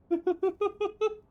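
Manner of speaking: high giggling burst; laughing at the mangled accents; real laugh. Real, not acted. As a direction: laugh it off, embarrassed. Laughs at his own accents.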